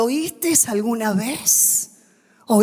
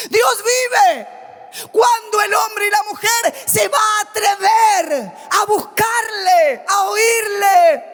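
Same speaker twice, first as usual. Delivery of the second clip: full-force pulpit preaching; fiery preaching; 10 semitones higher than usual. Acted. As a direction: preach it to the whole church.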